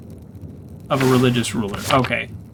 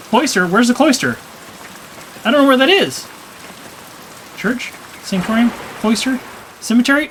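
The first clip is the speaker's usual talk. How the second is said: normal talking voice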